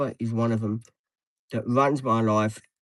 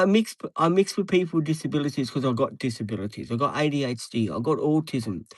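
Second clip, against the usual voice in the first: ordinary talk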